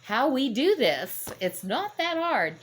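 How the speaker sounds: a sarcastic take on a know-it-all driver; mocking.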